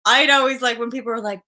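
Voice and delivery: ordinary talk, nothing marked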